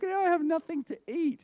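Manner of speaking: high-pitched comic whine of self-pity; hammed-up hungry complaint; mock lament. Retelling his hungry plight at gate.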